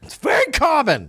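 high put-on voice, dismissive; Socrates voice. Socrates shrugs off the wife, high voice. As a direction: brush it off in the high voice. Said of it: "Socrates is so fucking tired".

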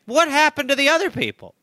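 mock naive question. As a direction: play it wide-eyed and puzzled. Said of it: "I keep saying".

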